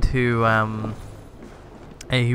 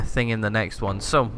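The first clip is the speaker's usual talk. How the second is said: ordinary talk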